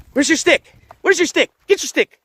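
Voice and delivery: high, eager prompting; playful dog talk. Real, not acted. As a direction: egg the dog on to fetch. Urging dog to fetch stick.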